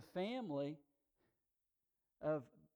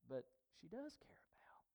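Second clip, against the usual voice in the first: sudden hushed whisper; 13 dB quieter than usual. Acted. Preacher whispering to the congregation. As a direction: drop to a secret whisper.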